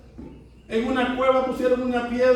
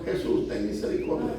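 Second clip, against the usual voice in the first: low, soft plea; inward cry; 5 semitones lower, 7 dB quieter than usual. Acted. Sinner pleading privately to Jesus, quiet inward cry. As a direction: pray it under the voice, as if only inwardly. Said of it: "quizás para adentro".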